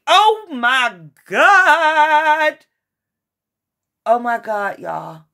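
slow, loud cry of amazement; loud drawn-out disbelief; amazed outburst. Real, not acted. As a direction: overcome by the card that just came out. Amazed at twin flame card.

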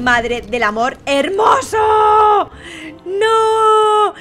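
slow build to a long cry; dramatic wail. Real, not acted. Host loses another contestant, drawn-out dramatic wail. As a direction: stretch it out, rising into a wail. Reacting to player's final loss.

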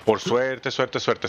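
"Por suerte" punched, then "suerte, suerte" echoed; stress on por suerte. Shown as a demonstration. Gamer repeating "por suerte" for emphasis. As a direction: hammer "suerte" over and over. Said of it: "mucho énfasis en por suerte".

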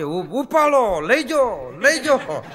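loud pleading ringtone; urgent ringtone voice. Retold or imitated. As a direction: mimic a phone begging to be picked up. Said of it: "ઉલડતો આવશે". (મોટેથી વિનંતી કરતો ringtone)

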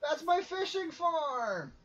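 slow, high, indignant cry; outraged. Real, not acted. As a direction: yell it in outrage. Repeats louder in outrage.

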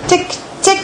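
small high-pitched tick-tick; crisp little high ticks, evenly spaced; ticking sound. A narrator's reading. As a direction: tick like a tiny hammer tapping. Imitates hammer ticking sound.

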